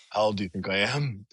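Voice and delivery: low, soft put-on phone voice; prank caller. Host imagining crank calling strangers, low prank caller voice. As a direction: drop down low as a pretend mystery caller. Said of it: "call people and be like".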